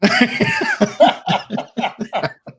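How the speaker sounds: loud, high laugh at the joke; high burst of laughter. Real, not acted. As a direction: crack up at the player's line.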